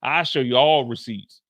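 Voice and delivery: ordinary talk, nothing marked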